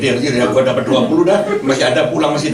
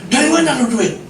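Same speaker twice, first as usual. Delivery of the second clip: higher-pitched retold challenge; suspicious wife; 8 semitones higher than usual. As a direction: voice the wife demanding an explanation. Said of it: "saya kasih duit istri saya".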